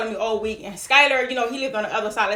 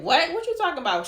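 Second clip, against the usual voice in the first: taken aback; 7 semitones higher than usual. Retold or imitated. Retold reaction to girl's insinuation.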